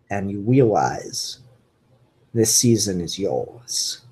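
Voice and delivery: low, measured noir narrator with w-for-r lisp; Elmer Fudd narration. Acted. Reviewer reads out Elmer's closing narration, low and brooding. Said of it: "with Elmer's narration".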